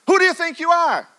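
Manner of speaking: high, loud and demanding; a sudden high shout of challenge; loud challenging question. Acted. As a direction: hurl the question at them. Preacher repeats question, building.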